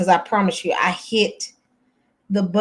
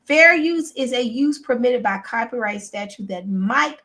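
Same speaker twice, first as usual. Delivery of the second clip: formal reading; 4 semitones higher than usual. Acted. Reads legal disclaimer aloud.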